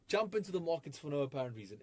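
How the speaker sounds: ordinary talk, nothing marked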